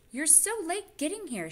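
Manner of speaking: put-on high child voice. Janice greets Mrs Frisbee.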